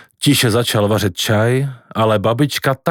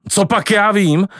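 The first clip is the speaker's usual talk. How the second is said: angry shout; 8 semitones higher than usual. A narrator's reading. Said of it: "křičel dědeček rozčileně".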